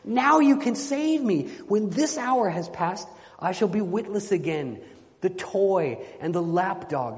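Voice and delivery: high, impassioned storybook begging; desperate plea. Acted. Enchanted knight begging his guests for rescue, desperate plea. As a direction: sustain a high, urgent plea that peaks on key phrases.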